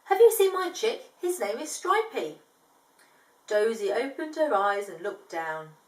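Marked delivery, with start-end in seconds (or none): 0.1-2.4 high, urgent question; worried dad asking. Acted. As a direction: ask with rising worry. Worried father asking around.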